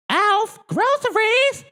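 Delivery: very high, loud shout; loud call. Acted. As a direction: yell up the stairs. Kate calling.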